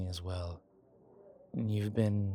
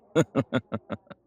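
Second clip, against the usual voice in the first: rippling chuckle, higher and louder; a short burst of chuckling; amused chuckle; 4 semitones higher, 7 dB louder than usual. Acted. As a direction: chuckle at your own admission. Laughs after admitting fear.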